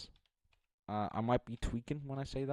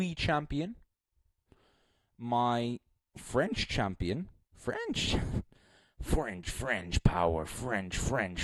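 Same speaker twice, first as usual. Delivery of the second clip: drawn-out, much louder ring-announcer build-up; hype announcer intro; 5 dB louder, slower than usual. Pretend. Hyping his favourite beatboxer to chat like an announcer. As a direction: announce him like a champion stepping in the ring.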